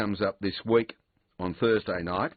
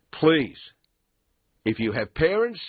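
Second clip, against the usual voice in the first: loud, high urgent plea; 7 semitones higher, 5 dB louder than usual. Real, not acted. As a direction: call out to listeners, pleading. Appeals to listeners' families.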